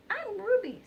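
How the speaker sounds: high, loud hen voice for Ruby; chicken voice. A narrator's reading. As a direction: squawk it out like a cheerful hen. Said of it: "said the chicken".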